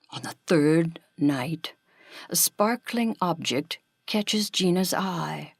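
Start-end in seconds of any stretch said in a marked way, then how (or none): none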